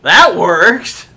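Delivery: loud, high, incredulous exclamation; amazed outburst. Real, not acted. Gamer sees a silly trick succeed, shouted astonishment. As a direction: burst out in real surprise. Amazed that bat throw worked.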